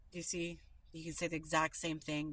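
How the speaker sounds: normal talking voice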